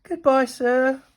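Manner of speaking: slow, high singing-out farewell; loud, stretched mock-polite goodbye; calling out. A narrator's reading. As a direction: call it cheekily across a playground. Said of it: "called out Rebel".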